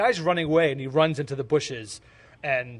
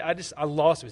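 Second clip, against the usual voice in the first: normal talking voice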